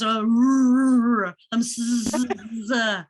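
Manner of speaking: lightly voiced Fonz; Fonz impression. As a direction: try to do the Fonz. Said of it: "like the Fonz".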